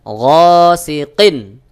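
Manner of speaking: "গসিকিন" stretched very slowly and loud; slow recitation. Shown as a demonstration. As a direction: draw out "গসিকিন" in chant. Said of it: "ওইভাবেই উচ্চারণ করতে হবে". ("গসিকিন" টেনে তিলাওয়াত)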